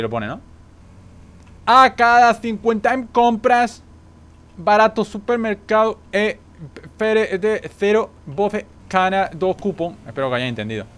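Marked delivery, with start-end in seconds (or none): 1.6-10.0 high, slow fake Brazilian Portuguese; mock Brazilian accent. Player reading game text aloud, mock Brazilian accent. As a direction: read it out in a hammed-up Brazilian accent. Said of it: "Mi brasileño es perfecto".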